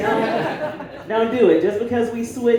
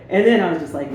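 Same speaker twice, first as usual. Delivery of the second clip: normal talking voice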